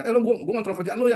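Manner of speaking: high, loud bossy boss laying down the law; bossy controlling boss. Retold or imitated. Boss telling a subordinate who is in control, bossy.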